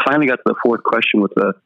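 ordinary talk

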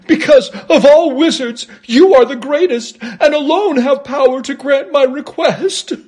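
high-pitched, quavering plea with a nervous chuckle; cowardly lion, pleading. A narrator's reading. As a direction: beg the wizard in a timid high voice.

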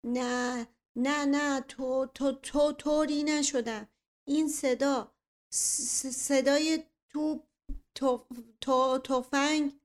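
trembling, broken, slow words; through gritted teeth. Acted. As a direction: stammer in fright through clenched teeth. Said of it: "با صدای لرزان و کلمات بریده از لای دندانها".

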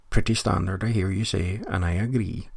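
usual speaking voice

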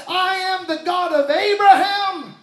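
a hint of a solemn booming God; God declaring himself to Moses, proclaiming. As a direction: proclaim each "I am" grandly. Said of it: "the God of this bush said".

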